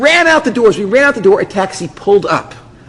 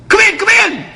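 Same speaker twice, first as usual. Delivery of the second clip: high-pitched, jittery mock voice; nervous voice; 3 dB louder than usual. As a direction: squeal it like a nervous woman. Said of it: "very nervous sounding voice says".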